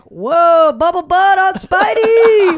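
high, loud ogling whoop; leering catcall. Retold or imitated. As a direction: whoop at him like a leering fan. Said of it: "people were like".

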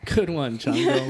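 high cheery praise; singsong puppet show host. Acted. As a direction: praise a toddler brightly. Praising baby on mic.

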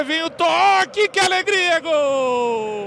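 builds to a shouted goal; excited goal build-up; rising goal call. Real, not acted.

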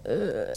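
soft low weird groan. Retold or imitated. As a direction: groan quietly like a stranger. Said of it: "making weird groaning sounds like".

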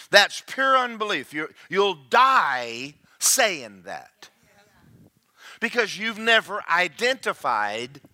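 high, loud sermon voice driving each point home; forceful preaching. Real, not acted. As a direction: preach it hard with punched key words.

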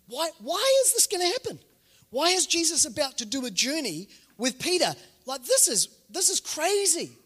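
high-pitched, incredulous questioning; incredulous, slowing on the last word; baffled disbelief. Acted. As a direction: act out bewildered protest, pitch right up. Voicing bafflement at Peter's coming fall.

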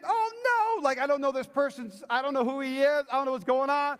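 high, loud comic dismay; mock panic. Preacher fails to recognize a friend, high loud mock panic. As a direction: fret in exaggerated alarm. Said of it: "And so I'm thinking".

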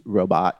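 "robot" with a full, open "bot"; Hamburg pronunciation. Shown as a demonstration. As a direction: say "robot" as ROH-BOT, second vowel clear. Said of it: "the Hamburg pr-, pronunciation".

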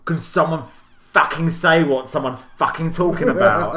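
exasperated in-laws shouting over rapid chatter. Retold or imitated. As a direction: bark out the frustrated demand. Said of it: "both went".

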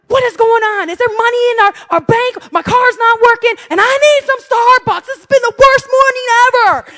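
high, loud exasperated tirade; steaming rant. Retold or imitated. Mom ranting to husband by phone, steaming. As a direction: build to a loud, high wail of frustration. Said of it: "I'm steaming at this point".

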